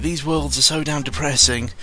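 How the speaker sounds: ordinary delivery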